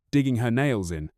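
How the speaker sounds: ordinary delivery, nothing marked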